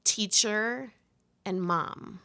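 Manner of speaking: ordinary talk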